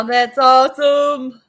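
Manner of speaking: loud, slow delighted exclaim; excited. Real, not acted. Grower thrilled with her plants, loud and slow. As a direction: belt out the delight.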